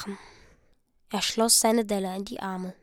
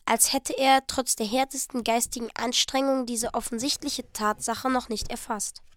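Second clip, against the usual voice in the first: usual speaking voice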